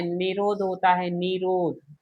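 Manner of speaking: निरोध with a long, held 'nee' in the first syllable; long first vowel. Shown as a demonstration. Teacher warning it may be asked as a spelling question, निरोध with long ee. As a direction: repeat निरोध carefully as 'nee-rodh', long first vowel. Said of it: "ये शब्द शुद्ध शुद्ध में भी पूछ सकता है".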